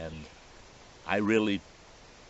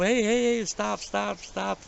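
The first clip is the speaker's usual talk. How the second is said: yelling to stop; 12 semitones higher than usual. Retold or imitated. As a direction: call out hey hey, stop stop, urgently. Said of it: "I'm yelling at him".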